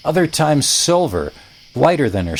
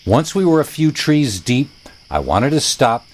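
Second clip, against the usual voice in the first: ordinary delivery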